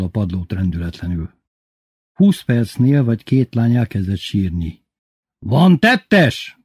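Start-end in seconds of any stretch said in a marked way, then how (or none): 5.4-6.6 high, raised-voice demand; bellowed question. A narrator's reading. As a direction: bellow it at the marchers. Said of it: "bömbölte Titi". (bömbölő számonkérés)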